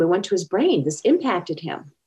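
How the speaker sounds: ordinary talk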